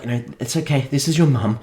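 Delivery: a light mock of a cooing mother-to-be; mock tender mum. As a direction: imitate her talking sweetly to the baby. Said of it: "she's like".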